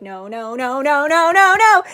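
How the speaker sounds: a string of no's climbing into panic; cartoon bathtub-crash panic, rising steadily; Cleveland from Family Guy. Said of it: "like Cleveland from Family Guy".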